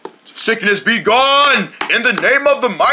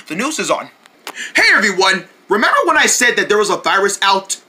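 normal talking voice

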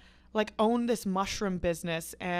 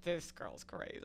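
muttered judgement; 4 semitones lower, 13 dB quieter than usual. Retold or imitated. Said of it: "they're like".

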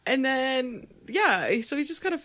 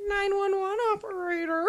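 pinched-nose nasal voice, higher; 8 semitones higher than usual. Retold or imitated. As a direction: talk through a pinched nose like a fake operator. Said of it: "Pinching his nose".

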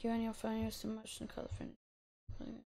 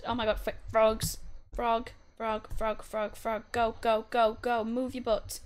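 excited; 6 dB louder than usual. Real, not acted. Excited chasing frogs in game.

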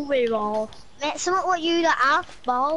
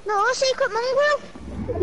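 excited; 8 semitones higher, slower than usual. Real, not acted. Announcing friend's gamer name.

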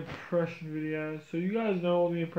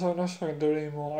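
normal talking voice

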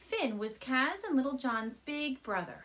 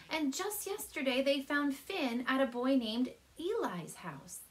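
plain reading voice

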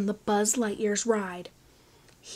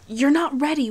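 a loud, higher urgent hiss; 5 semitones higher, 7 dB louder than usual. A narrator's reading. Wayne warning Finn from shadows, urgent hiss. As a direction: warn him sharply from the shadows. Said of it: "Wayne hissed at Finn".

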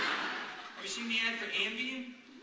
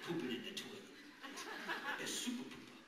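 soft low cooing; baby talk; 5 semitones lower, 8 dB quieter than usual. Acted. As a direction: coo like a doting parent. Parents baby-talking.

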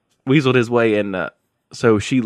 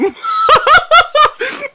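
loud high-pitched laugh burst; loud shrieking laugh; high laughter; 8 dB louder than usual. Real, not acted. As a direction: cackle in disbelief. Laughing at the scene.

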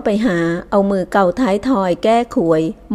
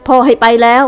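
higher, louder eager shout; loud call; 4 semitones higher, 7 dB louder than usual. Acted. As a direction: call out loud, begging to come along. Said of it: "พูดเสียงดัง". (ตะโกนเสียงดังอ้อนขอไปด้วย)

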